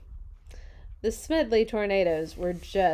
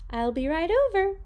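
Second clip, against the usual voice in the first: high, loud eager voice; mystery voice; 9 semitones higher, 5 dB louder than usual. Acted. Voice on the phone answering Miss Nelson, high and loud. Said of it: "said the voice".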